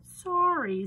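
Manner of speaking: high loud squeaky apology; high piglet voice. Acted. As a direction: squeal sorry like a little pig.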